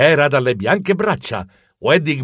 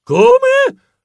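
very high, loud startled yelp; rabbrividendo; 6 dB louder than usual. Acted. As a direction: squeak it out in alarm. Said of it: "rabbrividii io". (strillo acuto di spavento)

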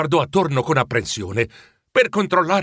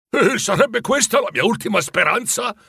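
high-pitched, incredulous retort; 8 semitones higher than usual. A narrator's reading. Scrooge answering the ghost about his last hope, retorting. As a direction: question it back in dismay. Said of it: "ribatté".

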